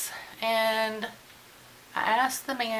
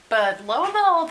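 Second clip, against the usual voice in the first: lo and behold, pitched way up and loud; dramatic surprise; 9 semitones higher, 7 dB louder than usual. Real, not acted. Vlogger to viewers, mock-dramatic surprised reveal. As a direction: announce the twist like a big reveal.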